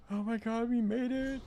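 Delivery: high, thrilled cry; excited. Real, not acted. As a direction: blurt it out in high delight. Excited shout of arrival.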